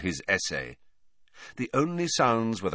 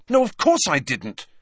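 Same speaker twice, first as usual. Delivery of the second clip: higher, louder hasty denial; quick denial; 8 semitones higher, 5 dB louder than usual. A narrator's reading. Boy cutting off an accusation, denying it hastily. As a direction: cut in to deny it. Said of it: "said Harry quickly".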